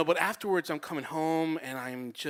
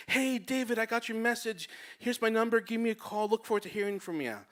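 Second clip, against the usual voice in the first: imitating a woman's higher, singsong phone message; cheery voicemail; 6 semitones higher than usual. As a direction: leave a friendly message, pitched up.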